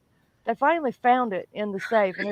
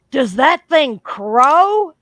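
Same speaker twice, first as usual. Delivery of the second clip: slow, loud, higher-pitched disbelieving question; incredulous question; 4 semitones higher, 9 dB louder, much slower than usual. Retold or imitated. Store girl asking about the odd ringtone, incredulous. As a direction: draw it out in mock disbelief, rising hard on crow.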